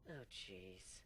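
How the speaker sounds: plain reading voice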